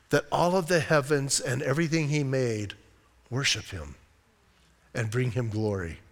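low, soft, with long pauses; hushed reverence. Real, not acted. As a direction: drop right down in quiet awe. Reflective moment on creation's worship.

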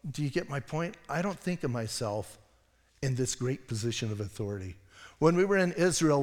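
usual speaking voice

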